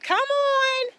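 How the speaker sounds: very high, slow, drawn-out call; calling the cows. Real, not acted. Farmer calling her cows from far off, very high long call. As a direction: sing it high across the field.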